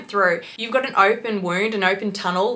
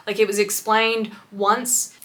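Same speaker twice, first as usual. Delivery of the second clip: ordinary talk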